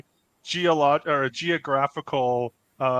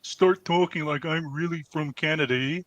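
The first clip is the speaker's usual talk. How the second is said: hammed-up Canadian with "eh"; Canadian accent. Shown as a demonstration. Host balancing out the English accents, hammed-up Canadian accent. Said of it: "get into my Canadian accents".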